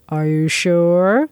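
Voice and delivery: slow, suggestive question rising at the end; oily, lingering come-on; slimy clerk. A narrator's reading. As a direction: draw it out, insinuating. Slimy clerk presses his offer.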